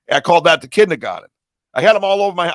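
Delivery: loud, excited outburst; real yelling. Real, not acted. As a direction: blurt it out loudly. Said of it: "stop yelling at me".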